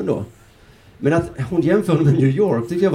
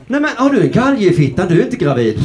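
louder, high-pitched mock astonishment; turned-up volume; 5 semitones higher, 4 dB louder than usual. Comedian replaying the shampoo advert as tougher joke, cranked-up incredulity. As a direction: crank up the outrageous question, high and emphatic. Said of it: "vred jag upp volymen högt".

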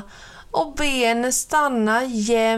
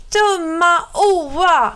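put-on higher, louder, slow childish yell; angry child shout; 8 semitones higher, 6 dB louder than usual. Sillan Per shouting at Oa, slow angry yell. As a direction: scream it out like a furious little girl. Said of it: "Hon gråter och skriker".

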